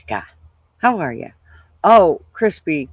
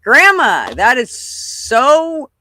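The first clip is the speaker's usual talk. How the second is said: higher, slow drawn-out mock shock on so; imitating granddaughter; 8 semitones higher, 5 dB louder, much slower than usual. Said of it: "she's like".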